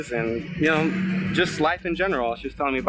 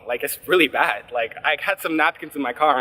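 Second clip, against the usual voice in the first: normal talking voice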